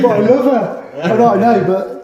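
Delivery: high, loud lovesick protest, retold; mock pleading. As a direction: plead hammily for a beloved old van. Rick pleading to keep beloved old van.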